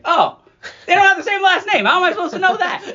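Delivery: high, loud, laughing disbelief; laughing exasperation. Retold or imitated. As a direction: laugh it out in mock outrage. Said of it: "And I was like".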